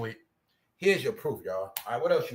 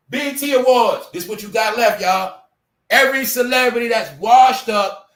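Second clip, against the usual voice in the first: raised voice, higher and louder, mocking the show; loud rant; 7 semitones higher, 8 dB louder than usual. Real, not acted. Host ranting to viewers about the awards, loud and mocking. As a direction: call out the awards loudly.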